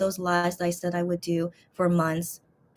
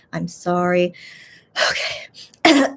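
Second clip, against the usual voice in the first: normal talking voice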